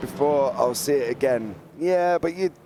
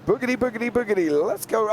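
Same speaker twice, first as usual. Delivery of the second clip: high-pitched race-start catchphrase; Darrell Waltrip; 10 semitones higher than usual. Acted. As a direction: imitate a commentator's rallying start call. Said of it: "We're gonna have Darrell Waltrip".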